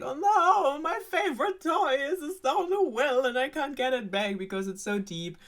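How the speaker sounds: high, loud, hammed-up lament; mock wailing princess. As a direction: wail over it like a spoilt fairy-tale princess. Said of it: "crying and crying and is like".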